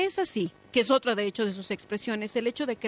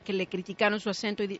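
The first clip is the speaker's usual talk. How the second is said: ordinary talk, nothing marked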